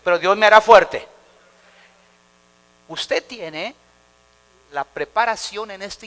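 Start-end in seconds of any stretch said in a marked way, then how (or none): 0.0-1.1 preacher leading a loud chant; loud call to repeat. Shown as a demonstration. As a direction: boom it out for the congregation. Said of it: "Diga conmigo".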